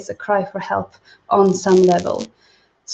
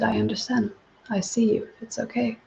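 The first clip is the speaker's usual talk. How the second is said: much softer, tender reassurance; soft comforting; 2 semitones higher, 8 dB quieter than usual. Retold or imitated. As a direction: soothe him gently, almost hushed. Woman comforts crying man, retold.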